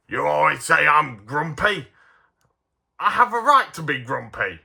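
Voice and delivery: lightly voiced grumpy complainer. Toy reviewer speaking as the grumpy dwarf, grumpy. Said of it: "that's why it's called Grumpy".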